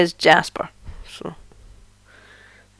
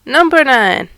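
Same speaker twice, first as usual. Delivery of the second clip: loud, sing-song number calling; bingo caller; 4 semitones higher, 3 dB louder than usual. Pretend. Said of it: "back to feeling like a bingo caller".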